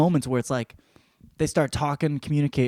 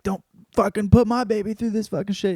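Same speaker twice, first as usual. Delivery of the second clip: desperate plea; 10 semitones higher, 4 dB louder than usual. Retold or imitated. Mothers at auction pleading.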